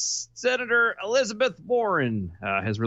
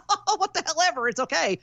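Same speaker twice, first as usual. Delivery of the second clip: mock carefree voice; 11 semitones higher than usual. Mocking carefree voice, laughing.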